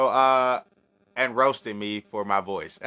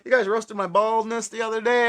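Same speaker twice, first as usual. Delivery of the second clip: higher, louder mock grievance; playful outrage; 13 semitones higher, 5 dB louder than usual. Real, not acted. Host teasing chat about baldness, higher, louder. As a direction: tease the chat back.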